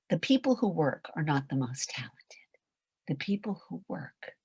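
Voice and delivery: hushed, breathy confiding whisper. Real, not acted. Coach whispering a secret truth to the listeners. Intimate point, voice dropped low.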